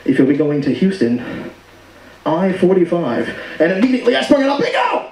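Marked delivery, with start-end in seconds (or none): 0.0-3.3 low, slow pilot intercom voice; pilot announcement. Retold or imitated. Pilot listing terminals, lightly voiced low drawl. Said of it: "he goes". 4.6-5.0 high excited shout; shouting bingo. Retold or imitated. Comedian on the plane, high triumphant shout.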